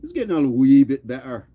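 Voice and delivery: playful Irish dad brogue; attempted Irish accent. Dad doing it to his daughter, put-on Irish accent. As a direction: lilt it like an Irish pa teasing his lass. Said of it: "I do it to my".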